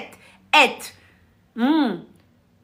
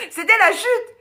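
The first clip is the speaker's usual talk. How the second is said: high punchline; 10 semitones higher than usual. Real, not acted. Repeats the punchline higher.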